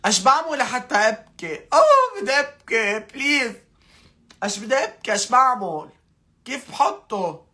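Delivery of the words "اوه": mock wail. Mock wail trying to cry.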